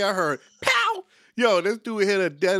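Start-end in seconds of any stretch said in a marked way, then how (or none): 0.6-1.0 sharp high pow for a punch; punch sound effect. Retold or imitated. Telling his friend about the punch, a sharp high pow. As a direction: smack out the sound of the hit.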